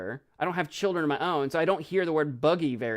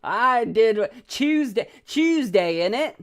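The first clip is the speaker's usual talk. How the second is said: hammed-up British "Tuesday, innit", higher and louder; mock British accent; 5 semitones higher, 5 dB louder, slower than usual. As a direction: play a cheeky Londoner. Mocking British speech.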